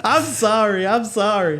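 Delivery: high pleading voice, half laughing; mock crying plea. Boyfriend on hands and knees begging his girlfriend, high mock sobbing plea. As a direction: beg her not to leave. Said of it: "And he's crying".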